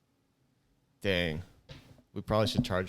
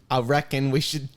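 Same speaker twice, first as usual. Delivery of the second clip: mock British accent; 5 semitones higher, 6 dB louder than usual. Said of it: "bad British accent".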